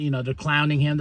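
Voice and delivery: ordinary talk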